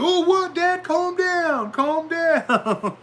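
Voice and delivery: put-on high, loud whiny kid brushing dad off; mocking kid voice. Kid telling his angry dad to calm down, high whiny mock. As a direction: play the sassy kid telling dad to chill.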